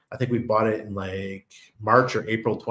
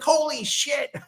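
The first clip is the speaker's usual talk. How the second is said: mock amazed mom, pitched way up; amazed exclamation; slower than usual. Imitating mom hearing about jackpot wins, high-pitched amazement. Mom's amazed reaction, acted out.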